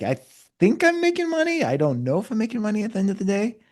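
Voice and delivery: high, uncertain mock voice; squeaky mock bewilderment; unsure business owner. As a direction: play a confused owner who has no idea.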